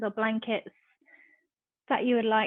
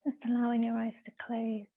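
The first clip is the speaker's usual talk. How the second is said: measured meditation voice with long pauses; guided meditation. Real, not acted. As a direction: guide them into stillness, phrase by phrase.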